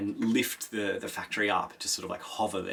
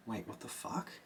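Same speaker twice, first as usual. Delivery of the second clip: hushed disbelief; 13 dB quieter than usual. Retold or imitated. Kynes stunned by Paul's stillsuit.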